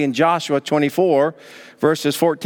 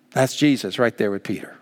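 ordinary talk

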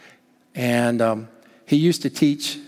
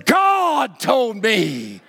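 very slow, weighty, every word landed; the punchline, said with awe; slow solemn reveal; 7 semitones higher, much slower than usual. Retold or imitated. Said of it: "Campolo says".

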